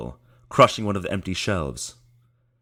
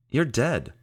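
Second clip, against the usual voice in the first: high, startled blurt; Michael blurting at the ghost; surprised blurt; 8 semitones higher than usual. Acted. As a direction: speak before you think.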